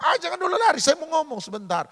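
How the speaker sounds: high call after a fleeing friend; calling after someone. Retold or imitated. Preacher calling after A to stop him. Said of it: "saya bilang".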